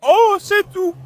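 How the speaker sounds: high, loud tearful wail; crying. Real, not acted. Suspect answers officer about injuries, crying. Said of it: "Pourquoi tu pleures?".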